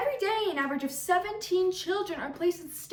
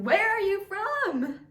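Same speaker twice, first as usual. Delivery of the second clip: prying questioner; 5 semitones higher, 5 dB louder than usual. Acted. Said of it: "you'll get the occasional question".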